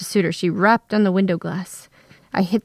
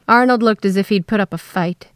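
usual speaking voice

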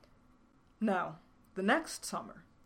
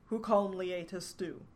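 usual speaking voice

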